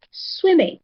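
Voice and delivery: "swimming" high on the first syllable, falling away; stress on SWIM. Shown as a demonstration. Teacher asks student to repeat it, high stressed first syllable of "swimming". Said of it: "receives the higher voice".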